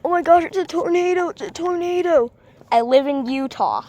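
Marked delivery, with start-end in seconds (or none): 0.0-2.3 high, fast, louder panicky voice; mock panicked commenter. Mocking a panicky viewer who comments about a tornado, fast and high. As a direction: squeal it like a frantic viewer. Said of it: "don't comment".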